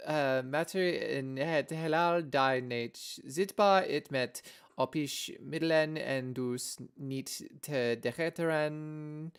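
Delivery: slow, loud learner's Dutch reading; labored foreign-language reading at full volume; halting Dutch read-aloud. Shown as a demonstration. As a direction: sound out Dutch word by word, loudly, with hesitations. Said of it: "Did I read it well?".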